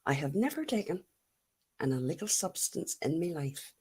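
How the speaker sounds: hushed mock confession, almost voiceless; whisper. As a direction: whisper it like a naughty secret.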